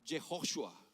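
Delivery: Jehoshua said carefully as a Hebrew name; Hebrew pronunciation. Shown as a demonstration. Preacher showing Joshua's Hebrew name, Jehoshua pronounced carefully. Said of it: "se pronuncia".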